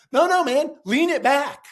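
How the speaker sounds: loud, high, urging command; emphatic insistence. Retold or imitated. Insisting his son recline.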